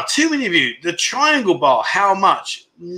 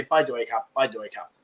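soft invitation; 7 semitones lower, 8 dB quieter, faster than usual. Shown as a demonstration. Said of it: "You say".

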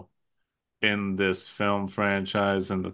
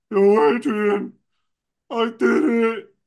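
Rocky's victory yell; 10 semitones higher, 6 dB louder than usual. Acted. Imitating the boxer yelling to his wife after winning, triumphant yell. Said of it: "And then he yells".

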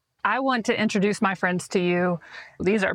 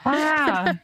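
laughing wow; 7 semitones higher than usual. Real, not acted. Host laughing at time passed.

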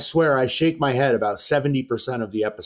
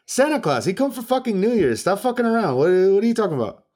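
much higher and faster, indignant mock rant; mock outraged foreigner; 7 semitones higher than usual. Host mimicking Florian scolding the producers about Santa, high indignant rant. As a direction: squeal it like a guy outraged at a silly question.

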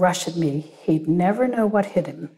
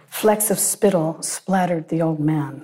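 ordinary delivery

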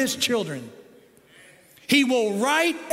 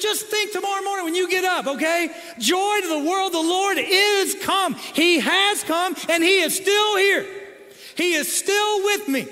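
full-force preaching; 8 semitones higher, 6 dB louder than usual. Acted. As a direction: proclaim it to wake up the room.

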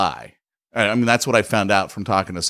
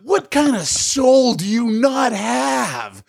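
high, indignant tirade that climbs higher; furious at the salesman in the showroom, rising outrage; outraged rant; 12 semitones higher, slower than usual. Retold or imitated. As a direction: demand an answer in disbelief. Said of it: "I'm like".

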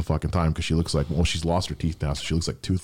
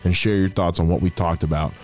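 projected, upbeat host sign-off; podcast outro read; 4 semitones higher than usual. Acted. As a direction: send listeners off with announcer-like polish.

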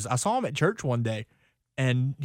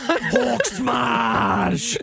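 booming Hulk roar, laughing; 9 semitones higher, 4 dB louder than usual. Pretend. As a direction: growl it like an angry green giant. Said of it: "the Hulk".

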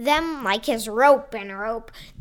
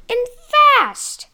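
ordinary delivery, nothing marked

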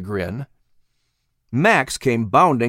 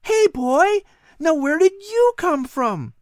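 put-on high, eager boy voice; a curious boy talking to a cat; young boy Patrick; slower than usual. Boy Patrick wondering aloud to the cat, high and eager.

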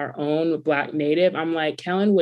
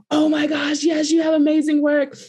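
gushing delight; 9 semitones higher than usual. Retold or imitated. Designer recognizing the speaker's brand, high excited gush.

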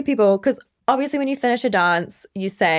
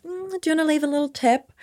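softer, higher, coaxing question; coy request; 7 semitones higher, 4 dB quieter than usual. Retold or imitated. Dancer to customer who paid, coy tip request. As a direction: ask it sweetly and lightly, pitched up.

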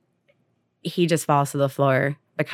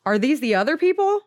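high, disbelieving questions, acted out; distressed confrontation; 6 semitones higher than usual. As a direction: confront a betrayer, voice pitched up. Retold boy confronts adopted father.

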